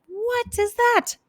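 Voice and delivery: high, slow, stretched what is that; alarmed surprise. Retold or imitated. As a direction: gape at the strange boom. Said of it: "they're like".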